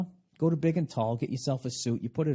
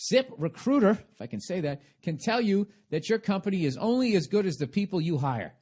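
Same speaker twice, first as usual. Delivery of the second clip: upbeat sponsor read, a bit higher; 6 semitones higher than usual. Acted. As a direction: pitch the sponsor like a radio host.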